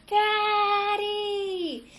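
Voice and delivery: long high shouted call; boy yelling to wake his sleeping father; yelled as loud as he could. A narrator's reading. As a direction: bellow it to wake him. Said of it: "yelled as loud as he could".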